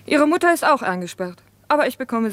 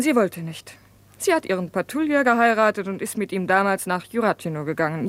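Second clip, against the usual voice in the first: usual speaking voice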